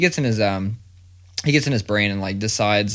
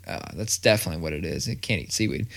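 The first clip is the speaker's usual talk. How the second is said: put-on lower, softer, fast kid mumble; mock mumble; 5 semitones lower, 6 dB quieter, faster than usual. Dad mimicking his son insisting on the seaweed, low fast mumble. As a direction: rush it under your breath. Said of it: "he's like".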